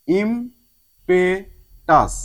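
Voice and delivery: "ইমপেটাস" in three very slow, separate beats; drawn-out, syllable-by-syllable "ইমপেটাস"; split into syllables. Shown as a demonstration. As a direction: pause between each part: "ইম-পে-টাস". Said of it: "তিন ভাগে আমি".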